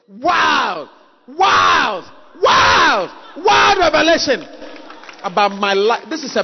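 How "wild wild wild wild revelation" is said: preacher working the crowd up to a shout; building shout. Real, not acted. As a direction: build it to a cry. Building wild wild wild.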